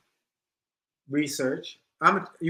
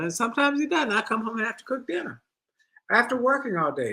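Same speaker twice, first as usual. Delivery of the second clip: higher, mock-whiny wife complaining; playing the fed-up wife, pitched up; wife's complaining voice; 8 semitones higher, faster than usual.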